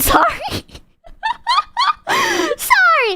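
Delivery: high squeal of a laugh through sorry; laughing apology. Real, not acted. Apologizing to chat for a tangent, laughing. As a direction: giggle out the apology, can't stop.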